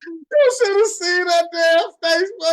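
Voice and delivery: high and loud through laughter; gleeful howl at her face; laughing loud. Real, not acted. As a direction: crack up telling it. Laughing recounting her reaction.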